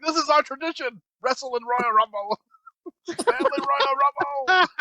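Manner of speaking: high mock dad announcer; insistent dad. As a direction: declare it like a dad hyping backyard wrestling. Dad overrules kids in the cold.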